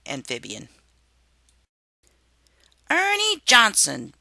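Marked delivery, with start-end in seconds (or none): 2.9-4.1 sudden high loud outburst of a name; blurted out. A narrator's reading. Mother worm interrupting her husband's story, loud blurt. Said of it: "Mother Worm suddenly blurted out".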